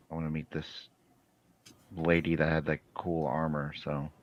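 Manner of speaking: ordinary delivery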